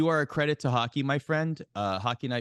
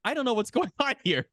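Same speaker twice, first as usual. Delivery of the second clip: laughing; 10 semitones higher, faster than usual. Retold or imitated. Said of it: "And I'm like".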